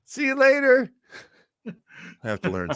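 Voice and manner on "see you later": high, bright hello to a guest; cheery greeting voice. Retold or imitated. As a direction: greet her sing-song. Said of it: "Lo was just able to".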